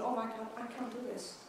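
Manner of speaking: normal talking voice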